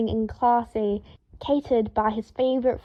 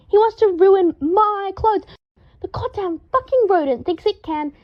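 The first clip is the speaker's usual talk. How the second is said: hysterical yell; 9 semitones higher, 5 dB louder than usual. A narrator's reading. Draco yells hysterically at Harry about the ferret. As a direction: wail it in hysterics. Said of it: "Draco yelled hysterically".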